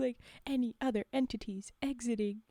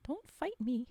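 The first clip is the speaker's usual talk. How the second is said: ordinary talk, nothing marked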